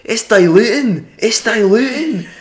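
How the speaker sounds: loud, high, drawn-out Scottish complaint; mock an outraged Scot, slow and high; Scottish accent. Scottish customer complaining about the lime, high Scottish accent. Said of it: "these Scottish fellas came up".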